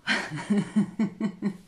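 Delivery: loud open laugh; bursting laugh; hearty laugh. Real, not acted. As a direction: laugh out freely. Laughter yoga teacher laughs.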